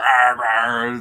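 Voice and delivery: growling like a wolf; rough playful growl. Retold or imitated. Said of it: "starts going".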